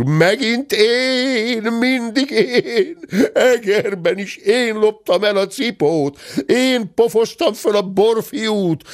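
high, long-drawn wailing protest; outraged howl. A narrator's reading. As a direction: howl out every grievance, stretching the vowels. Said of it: "üvöltötte dörmögő úr".

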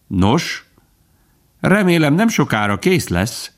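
high, cheerful squirrel greeting. A narrator's reading. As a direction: lift the pitch in friendly good cheer. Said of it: "szólalt meg a közelében egy vidám hang". (vidám, magas hangon)

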